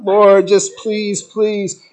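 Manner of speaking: slow, loud begging please; a mock desperate prayer, slowed down; pleading prayer. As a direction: drag out the plea to the Lord. Praying group pleads again.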